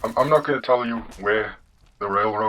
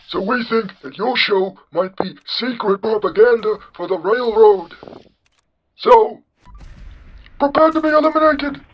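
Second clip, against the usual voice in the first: put-on high, loud old-man accuser; John Henry; 11 semitones higher, 5 dB louder than usual. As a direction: build to a very high, comic threat. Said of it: "you have the generic old man voice".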